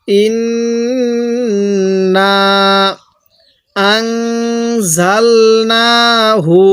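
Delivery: ইন্না stretched slowly then আনজালনাহু; slow recitation. Shown as a demonstration. Teacher restarts the verse slowly for the class. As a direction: recite deliberately. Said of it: "তাহলে আরেকবার এখান থেকে".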